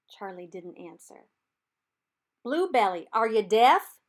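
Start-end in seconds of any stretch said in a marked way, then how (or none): 2.4-4.0 calling across river. A narrator's reading. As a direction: throw it across the river, teasing. Reb calls across the river.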